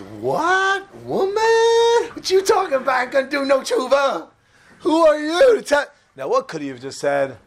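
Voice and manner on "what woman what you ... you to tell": defiant sinner. Retold or imitated. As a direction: play him scoffing at the woman, way up high. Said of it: "So Elizabeth the Dai says".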